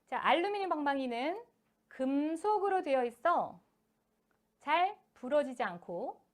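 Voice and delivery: slow, deliberate reading, pausing after 방망이는; phrased reading. Shown as a demonstration. Teacher showing parents how to read aloud in chunks. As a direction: read it slowly in clear chunks. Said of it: "제가 계속 지금 끊어읽고 있습니다".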